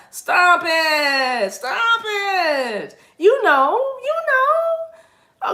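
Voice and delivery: high, drawn-out squeal; exaggerated squealed protest; playful scolding. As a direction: stretch it out in a high, loud tease. Teasing listeners about false humility.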